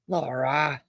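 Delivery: low, heavy sigh of a name; disappointed reproach. Real, not acted. Chiding Laura, low and let down.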